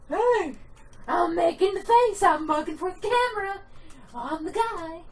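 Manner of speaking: high, loud mugging for the camera; exaggerated high voice showing off; goofy game character. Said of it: "And how he's always like".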